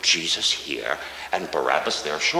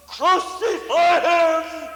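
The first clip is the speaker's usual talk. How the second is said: much higher, loud, slow drawn-out cry; crowd crying out; 4 dB louder, much slower than usual. Acted. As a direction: stretch Crucify him into a roaring demand. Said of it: "You cried out".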